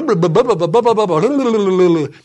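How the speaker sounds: loud lip-flapping gibberish; mock frantic babble. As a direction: babble nonsense as if overwhelmed. Mimes his panicked babbling.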